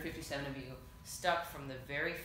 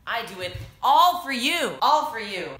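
emphatic; 5 semitones higher, 13 dB louder than usual. Acted. Heartfelt declaration to fans.

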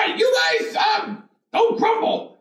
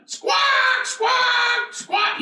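goose squawk; 4 semitones higher, much slower than usual. Retold or imitated. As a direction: call out like a noisy goose. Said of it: "And they're going".

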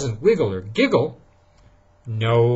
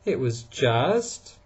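usual speaking voice

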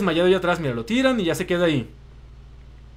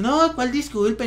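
drunk mocking; 6 semitones higher, much slower than usual. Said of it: "y empieza".

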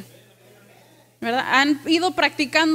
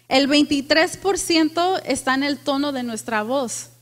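usual speaking voice

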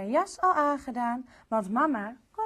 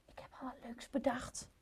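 quick secret whisper; queen whispering her plan to the king; 14 dB quieter, faster than usual. Acted. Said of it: "fluistert ze heel zacht".

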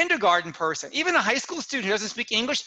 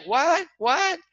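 high, rising double query; baffled; 8 semitones higher than usual. Retold or imitated. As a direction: mimic puzzled listeners. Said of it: "they're like".

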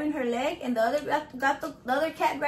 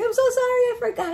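high, loud imitated apology; apologetic sister; 10 semitones higher, 4 dB louder, faster than usual. Storyteller imitating sister apologising, high mock-apologetic voice.

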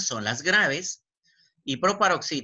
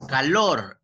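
"Calor" said louder, leaning on "lor"; acento en última sílaba; 5 dB louder than usual. Shown as a demonstration. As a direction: punch the final "lor" in "Calor". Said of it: "en lor". ("Calor" marcando con fuerza "lor")

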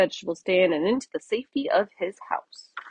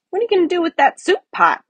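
curious bunny voice; 11 semitones higher, 5 dB louder, much faster than usual. Acted. Curious little rabbit asks.

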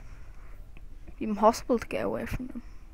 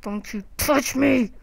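scared and angry; 3 semitones higher, 6 dB louder, slower than usual. Real, not acted. Ghost chasing him.

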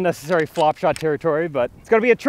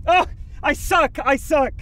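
frustrated shout; 12 semitones higher, slower than usual. Real, not acted. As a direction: groan it out after the missed putt.

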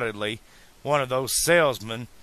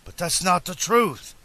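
calling out; 8 semitones higher than usual. A narrator's reading. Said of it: "Doc called to the wooden barrier".

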